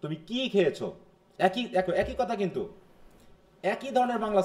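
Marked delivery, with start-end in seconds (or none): 0.0-0.9 কি stressed, খেয়েছো falling after it; single out কি as the thing asked. Shown as a demonstration. Teacher demonstrating a wh-question, stress on কি. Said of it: "যেটা কি এর উপরে".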